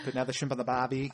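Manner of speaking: hammed-up Australian accent on a stock phrase. Taking up the dare to do an Australian accent, mock Aussie. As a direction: toss off a jokey Aussie accent. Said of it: "can anyone here do a decent Australian accent?".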